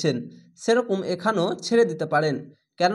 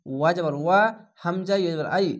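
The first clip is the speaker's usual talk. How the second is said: usual speaking voice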